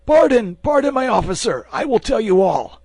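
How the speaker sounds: very high, loud frantic pleading; desperate begging. Acted. Bandit at sword point begs the officer, loud frantic pleading. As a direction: scream for mercy with everything you have.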